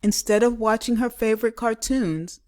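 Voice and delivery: ordinary delivery, nothing marked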